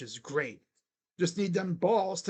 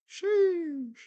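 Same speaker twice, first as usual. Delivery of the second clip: high, drawn-out falling exclamation; high-pitched reaction; exclaimed sheesh; 10 semitones higher than usual. Real, not acted. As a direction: let it slide down from a high squeal. Reacting to the game.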